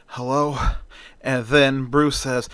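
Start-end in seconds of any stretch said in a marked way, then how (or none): 0.1-0.6 sleepy. A narrator's reading. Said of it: "with a sleepy tone in her voice".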